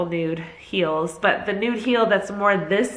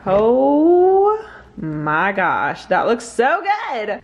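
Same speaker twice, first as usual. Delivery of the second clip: excited; 3 semitones higher, 4 dB louder, much slower than usual. Real, not acted. Air fryer food reveal.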